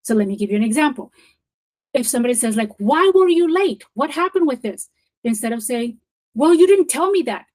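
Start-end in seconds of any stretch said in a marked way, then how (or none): 2.8-4.9 raised, higher-pitched accusing question; confronting. Acted. Someone challenging a colleague over lateness, confrontational. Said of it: "it's a little bit of an aggression". 6.4-7.5 louder, higher defensive comeback; defensive retort. Acted. Snapping back at an accusation instead of breathing, defensive. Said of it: "the tone of that conversation is going downwards".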